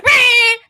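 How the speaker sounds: loud, high nasal honk of a car horn; car horn imitation. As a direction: blast it like a leaning-on-the-horn beep. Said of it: "she sits on the horn. She's like".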